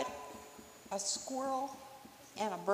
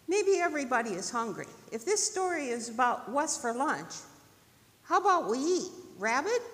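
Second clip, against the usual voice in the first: higher, lively squirrel voice; suggesting squirrel; 4 semitones higher, 8 dB louder, faster than usual. Acted. Squirrel proposing lunch to rabbit, persuasive. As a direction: start quick, lift into the question.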